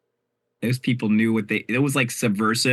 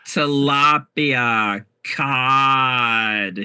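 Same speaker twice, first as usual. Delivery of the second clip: sassy drag queen; 2 semitones higher, 5 dB louder, much slower than usual. Pretend. Drawn-out sassy fish names.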